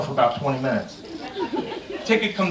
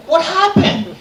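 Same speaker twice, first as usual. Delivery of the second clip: surprised; 13 semitones higher, 5 dB louder, slower than usual. Retold or imitated. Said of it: "I said".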